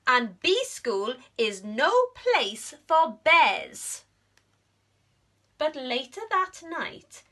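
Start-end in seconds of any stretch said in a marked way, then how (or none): 0.1-4.0 louder, firm royal scolding; stern queen. Acted. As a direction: lay down the rule. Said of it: "said the Queen".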